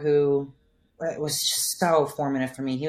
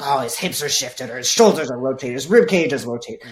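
louder, put-on voice picking faults; mock assessment; 7 dB louder than usual. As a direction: lightly mimic an over-analyzing coach. Mimics nitpicking coach's assessment.

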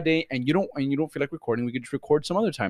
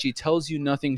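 usual speaking voice

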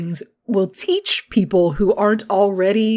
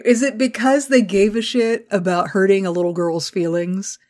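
ordinary talk

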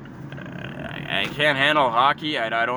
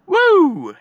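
very high, loud woo; loud high celebratory woo; whoop; 9 dB louder than usual. Retold or imitated. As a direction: let out a victory whoop. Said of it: "Oh, yes!".

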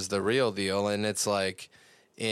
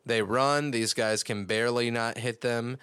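usual speaking voice

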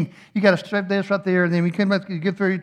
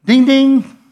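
loud, high two-note ding; imitated bell; 7 semitones higher, 9 dB louder than usual. Bell signalling the rider to release, loud high imitation. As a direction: chime out like an insistent bell. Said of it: "that bell goes".

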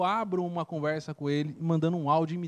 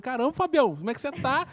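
surprised greeting; 7 semitones higher than usual. Retold or imitated. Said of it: "eu falei".

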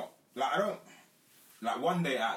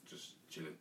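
quiet offhand reply; 3 semitones lower, 11 dB quieter than usual. Retold or imitated. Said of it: "I just say, like".

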